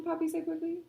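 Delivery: ordinary delivery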